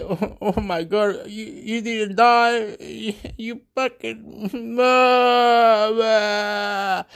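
high, slow, sobbing reunion cry; acted tearful relief. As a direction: break into drawn-out mock wailing. Acted reunion with brother from war.

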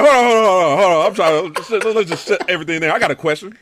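a hint of Triple H, hammed-up; imitating Triple H. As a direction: play the boss halting everything with a question. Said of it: "Triple H was like".

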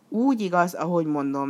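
plain reading voice

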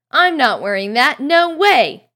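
flat-out refuse, voice rising; loud refusal. Acted. Ron refuses to wear the robes, loud protest.

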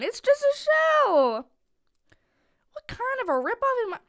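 high, loud whiny protest; indignant outburst. Real, not acted. Kid complains to dad about empty shell, indignant. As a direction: complain loudly, pitch climbing and words stretched.

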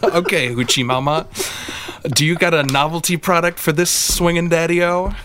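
a touch of hip jive talk; lightly voiced swinging daddy-o; cool beatnik. Bit between hosts.

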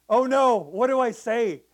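loud, slow "oh no" of a fretting pastor; mock panic. Missionary pastor voicing his own panic over an empty newsletter, loud mock dismay. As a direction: act out the month-end panic, loud and drawn out.

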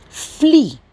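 Flee punched high and loud, then falling; stress on Flee. Real, not acted. Preacher reading a verse to listeners, Flee strongly emphasized. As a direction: hit the word Flee hard and high. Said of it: "I'm emphasizing the word flee".

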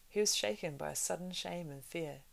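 ordinary delivery

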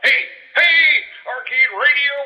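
ordinary talk, nothing marked

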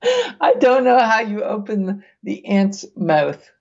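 giggling at the thought of it; laughing. Real, not acted. Host laughing while reading the method to the co-host.

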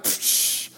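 soft "psh" splash noise; imitated splash. Small boy lands in the pool, imitated splash. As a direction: puff out a hissing water splash.

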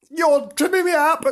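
high, loud protesting cry; dog crying out. Acted. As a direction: cry out while tripping. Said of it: "cried Nobs".

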